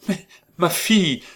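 high, loud desperate cry; a mother's sudden exclamation; explosive outburst. A narrator's reading. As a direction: burst out, pitch leaping up. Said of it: "fit la duchesse avec explosion".

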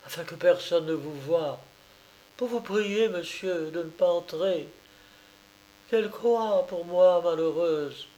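muffle the voice as if holding back sobs; a hint of muffled sobbing. A narrator's reading. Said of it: "surpris de la voix étouffée, sanglotante et fortement indignée".

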